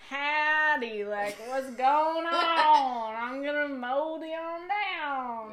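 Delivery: slow, loud, high Southern twang; hammed-up cowboy drawl; Texan accent. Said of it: "everyone has the".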